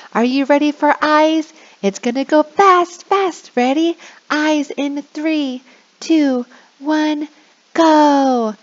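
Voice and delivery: bouncy game host countdown; excited countdown. Shown as a demonstration. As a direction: pump them up before the game starts.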